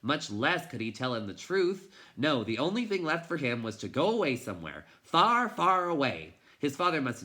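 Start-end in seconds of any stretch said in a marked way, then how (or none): none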